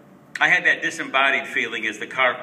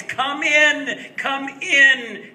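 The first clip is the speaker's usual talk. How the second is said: much higher and louder, a bright laughing welcome; 13 semitones higher, 7 dB louder, much slower than usual. Acted. Great aunt greeting her nephew at the door, laughing, much higher and louder. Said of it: "she laughed the words".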